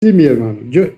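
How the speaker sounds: usual speaking voice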